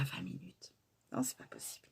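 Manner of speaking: shocked whisper of disbelief. Real, not acted. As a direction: gasp it out under the breath. Shocked at elapsed time.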